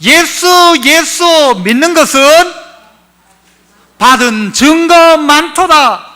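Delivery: high, loud preaching, slowed on the hymn line; fervent preaching. Acted. Pastor preaching fervently to the congregation. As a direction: preach it out with full force.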